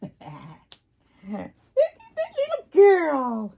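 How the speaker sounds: put-on high, loud cooing at the baby; baby talk. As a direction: gush over the little girl. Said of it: "baby talk".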